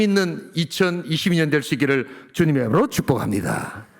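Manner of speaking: soaring, ringing pulpit voice; full-force preaching. Acted. Pastor blessing the congregation for 2022, full-force preaching. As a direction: preach it out to the whole church. Opening sermon exhortation.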